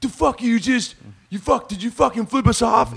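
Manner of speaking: high, furious street-thug shouting; angry gangster yell. Retold or imitated. Gangster yelling at a driver who flipped him off, furious. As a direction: bark it like a thug leaning out the window.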